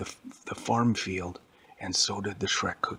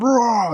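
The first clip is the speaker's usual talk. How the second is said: loud, higher roar; monster roar; 14 semitones higher, 11 dB louder than usual. Acted. Hulk ripping off the door, loud roar.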